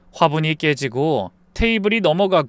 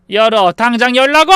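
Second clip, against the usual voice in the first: high, loud order to open up; demanding shout; 8 semitones higher, 8 dB louder than usual. Acted. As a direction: yell through a closed door, drunk and demanding. Drunk mother-in-law demanding entry.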